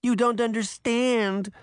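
put-on high boyish protest; crying out. A narrator's reading. Pinocchio protests that his papa misunderstands, crying out. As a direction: plead in a high, whiny child's voice. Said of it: "Pinocchio cried".

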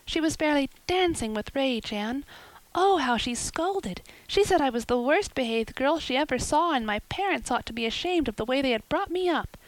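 put-on girl reporting her aunt's rage; lightly voiced excited girl. Diana telling Anne about the scolding, lightly voiced.

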